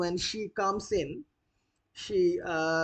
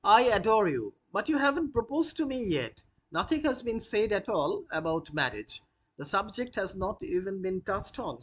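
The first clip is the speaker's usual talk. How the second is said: put-on higher, proper young lady setting him straight; Gwendolen, prim; 4 semitones higher than usual. Gwendolen telling Jack he hasn't proposed, prim and higher. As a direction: play her prim and a little pointed.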